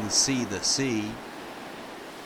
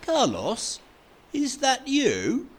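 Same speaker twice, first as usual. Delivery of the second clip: much higher, amazed question; surprised recognition; 4 dB louder than usual. Acted. Fred spotting Carlos by the sea, high surprised call. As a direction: sound delighted to spot an old friend.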